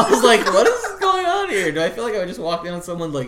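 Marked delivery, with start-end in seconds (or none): 0.5-1.7 much higher and louder, laughing; shocked. Retold or imitated. Walking in on his massacre stream, mock shock.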